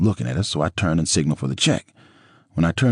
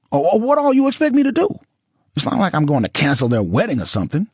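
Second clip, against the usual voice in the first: much higher, louder exasperated woman; ex-wife exasperated with her ex-husband, much higher; Nicole, exasperated; 13 semitones higher, 4 dB louder than usual. A narrator's reading. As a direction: burst out as Nicole, near a wail.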